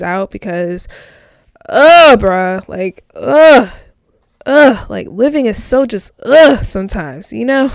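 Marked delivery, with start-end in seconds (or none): 1.7-7.3 loud groaning ughs of frustration; frustrated ugh. Real, not acted. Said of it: "I just screamed again".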